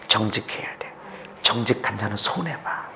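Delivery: breathy confidential whisper. Acted. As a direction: lean in and whisper it like a secret. Whispered aside in quote.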